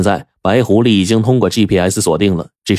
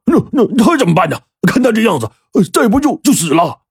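high, fast anxious stammer; worried simpleton; 14 semitones higher than usual. A narrator's reading. Henchman anxiously asks what to do with the wounded man. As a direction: fret over the dying man.

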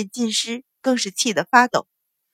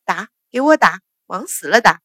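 louder, barked orders to beat her; furious command; 5 dB louder than usual. A narrator's reading. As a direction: shout the order at the servants in rage. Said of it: "秦氏指着他怒喝".

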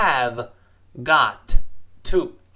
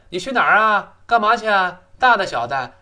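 loud, higher-pitched mock interrogation; nosy questioning; 7 semitones higher, 9 dB louder than usual. Teacher mimics friends asking where someone is going, nosy and loud. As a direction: pester with a volley of loud questions. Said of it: "就不用问".

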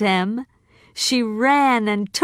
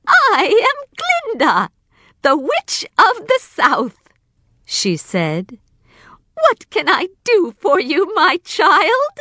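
high, light fairy-witch voice; good witch; 10 semitones higher than usual. Acted. Glinda greeting Dorothy, high and gentle. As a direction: float it up high and kind.

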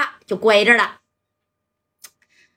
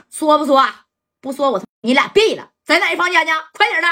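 threatening demand; 5 semitones higher than usual. Acted. Gangster threatening a scared waitress, harsh higher demand. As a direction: pitch climbing into a harsh higher bark.

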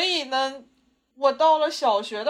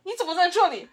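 shocked; 6 semitones higher than usual. Retold or imitated. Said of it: "就说".

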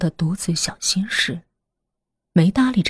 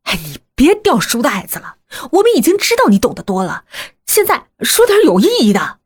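impatient, rising, louder interruption; 5 semitones higher, 4 dB louder than usual. Acted. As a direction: cut him off, exasperated. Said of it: "阿弟不耐烦地打断道".

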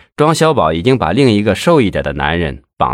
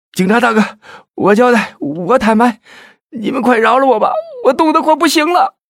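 much higher, desperate shouting plea; crying out loudly. A narrator's reading. Freezing suspect outside loudly begs the police for mercy. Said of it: "外面有人大声地疾呼".

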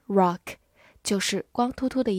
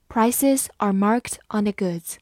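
a steady, natural pace; normal pace reading. Shown as a demonstration. Teacher reading at normal pace after the slow take. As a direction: read it through once at normal pace. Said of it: "慢慢来读".

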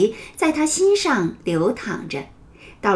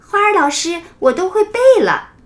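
put-on raised, eager child's call; 8 semitones higher, 4 dB louder than usual. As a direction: call out to the teacher, bursting to show off. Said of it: "同时叫道". (孩子兴奋地大声叫老师)

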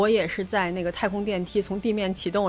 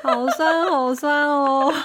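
mock jealous; 5 semitones higher, 5 dB louder, much slower than usual. Teasing reply to jealous jab.

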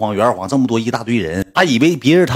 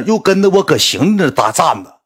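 ordinary talk

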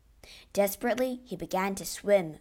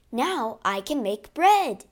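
excited; 3 semitones higher, 7 dB louder than usual. Acted. Crusoe finds ripe corn.

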